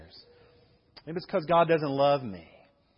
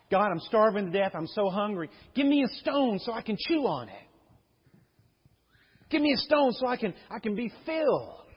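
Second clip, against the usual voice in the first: desperate hungry prayer; 4 semitones higher, 3 dB louder than usual. Acted. Voicing a hungry believer's prayer.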